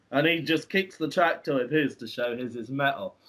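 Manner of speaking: ordinary delivery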